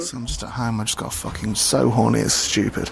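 low, husky role-play caller; low horny caller. Pretend.

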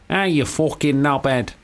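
slow, drawn-out Mancunian insult; Manchester accent. Shown as a demonstration. Host showing his warm-up phrase, Manchester accent. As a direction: draw it out in broad Manchester. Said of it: "for Manchester, I would have to go".

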